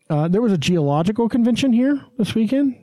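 rising question tune on "weekend", unsure. Real, not acted. Announcing the geology convention to cohosts, rising like a question. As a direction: lift "weekend" up like you're asking. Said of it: "Why you say that like a question?".

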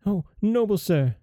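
high, pleading queen; great distress. A narrator's reading. Queen begging the dwarf, high distressed plea. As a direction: beg him desperately. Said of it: "said the queen in great distress".

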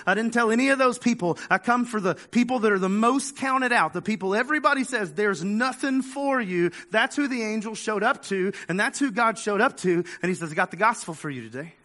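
preaching at full force. Acted. Preacher tells the church that the gospel starts at the bottom, full force. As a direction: drive it home to the congregation with fire.

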